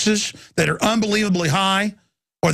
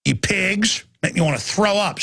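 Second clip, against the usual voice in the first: normal talking voice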